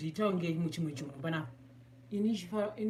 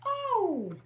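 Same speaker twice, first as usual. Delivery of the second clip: surprised oh; 14 semitones higher, 3 dB louder than usual. Real, not acted. Sudden surprise.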